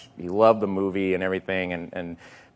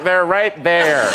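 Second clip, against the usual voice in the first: raised, slow and loud; retold exasperation; 6 semitones higher, 5 dB louder, slower than usual. Assistant pointing out the makeup guys, loud retold reply. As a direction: stretch it out, higher and louder. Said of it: "I was like".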